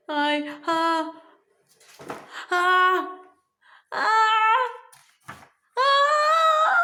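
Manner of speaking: ahs rising from plain to a high loud cry; building sneeze. Acted. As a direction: wind up toward a huge sneeze.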